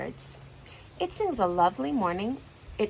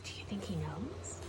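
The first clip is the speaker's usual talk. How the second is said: whisper; 13 dB quieter than usual. Acted. Asides to a friend.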